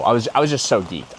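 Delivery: usual speaking voice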